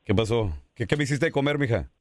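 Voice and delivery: marido macho más grave, llegando del trabajo; voz de marido rudo. Acted. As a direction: hazte el esposo exigente que llega a casa. Imita al esposo que llega a casa. (marido macho y grave pidiendo la comida)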